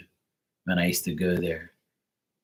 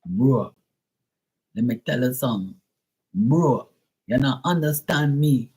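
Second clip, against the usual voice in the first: mock Jamaican-American accent; playful American-tinged Jamaican accent; 4 semitones higher than usual. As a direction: imitate an Americanized Jamaican, teasing. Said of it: "starting to sound like American Jamaican".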